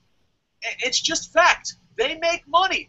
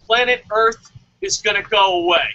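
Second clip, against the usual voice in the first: ordinary delivery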